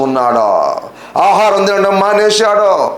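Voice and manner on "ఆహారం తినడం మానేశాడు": high, sustained preaching cadence; fervent preaching. Real, not acted. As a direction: build the list phrase by phrase, pitch held up. Preacher builds a list of troubles. (ఆవేశంగా, ఎత్తైన స్వరంతో ప్రసంగం)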